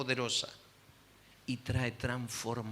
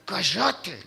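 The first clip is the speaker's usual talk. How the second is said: loud, higher, cutting; angry snap; 6 semitones higher, 8 dB louder than usual. Retold or imitated. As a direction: bite it off in anger. Marido rechaza a la esposa. (orden furiosa, más alta y fuerte)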